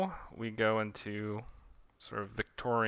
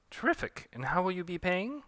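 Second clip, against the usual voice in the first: much higher chirpy shopkeeper; 8 semitones higher than usual. Acted. Shopkeeper asking for payment, much higher. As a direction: chirp the question brightly.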